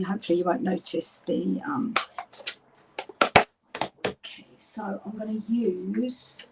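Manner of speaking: ordinary talk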